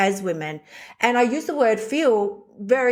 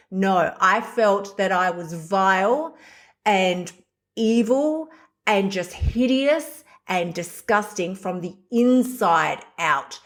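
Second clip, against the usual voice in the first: ordinary talk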